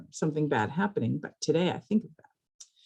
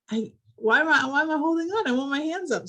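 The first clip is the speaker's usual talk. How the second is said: excited retold quote; 10 semitones higher than usual. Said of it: "I say".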